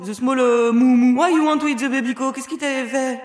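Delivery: grand discours, emphase de tribun; discours à la Martin Luther King. Pretend. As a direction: harangue la salle comme un orateur. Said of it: "de Martin Luther King". (discours inspiré à l'américaine)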